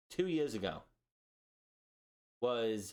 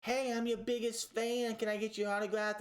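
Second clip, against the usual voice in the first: imitating an eager fan; 8 semitones higher than usual. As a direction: play the fake superfan begging for an autograph. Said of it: "and was like".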